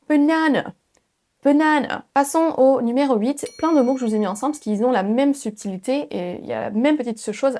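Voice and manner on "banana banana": BANANA with light schwas and high NA; A allongé. Shown as a demonstration. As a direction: stretch NA, fade to schwa in BANANA. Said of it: "ensuite on part sur un E". (BANANA, NA accentué puis E léger)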